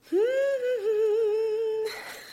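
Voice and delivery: a sudden high burst of laughter. Real, not acted. Host laughing at herself after losing her train of thought. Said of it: "That's so funny".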